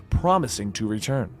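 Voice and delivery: plain reading voice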